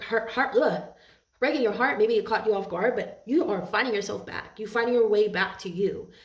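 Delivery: ordinary delivery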